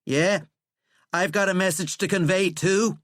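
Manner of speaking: angry comeback. A narrator's reading. President parroting Withering's phrase back, angry. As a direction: throw his phrase back at him. President mockingly echoes visitor.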